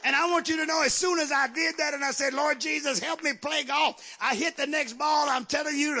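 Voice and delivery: high, loud, driving preacher's delivery; full-force preaching. Real, not acted. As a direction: preach it hard, pitch held high.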